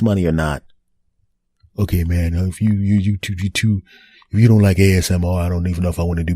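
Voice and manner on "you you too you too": ordinary talk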